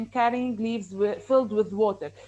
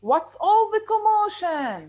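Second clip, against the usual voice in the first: high, louder, slow puzzled question; curious question; 9 semitones higher, 5 dB louder, slower than usual. Acted. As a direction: ask what the fuss is about. Grasshopper asks.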